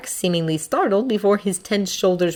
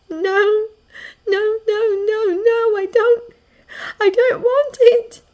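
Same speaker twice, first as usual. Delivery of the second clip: high, tearful pleading; anguished girl's high voice; distressed refusal; 3 dB louder, slower than usual. Acted. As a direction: plead as if close to crying. Distressed girl refusing in the story.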